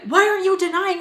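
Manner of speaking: loud, high mock accusation; high-pitched indignant demand; accusing. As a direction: imitate her shouting the accusation. Acted accusation in quarrel.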